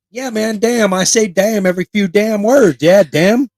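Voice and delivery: high, loud hillbilly holler; moonshiner accent. Acted. Playing a moonshiner who says damn constantly, hollered hillbilly accent. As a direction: whoop it up in a backwoods accent. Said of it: "like an episode of Moonshiners".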